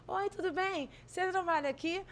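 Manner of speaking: high, soft and sweet, charming the nurse; sweet asking voice. Retold or imitated. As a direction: sweeten your voice to win a favour. Asking nurse sweetly at ICU door.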